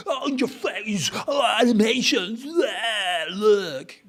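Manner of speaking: high, loud, over-the-top showoff voice; mock flashy website. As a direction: ham up a tacky site shouting at the viewer. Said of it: "become one of those sites that is like".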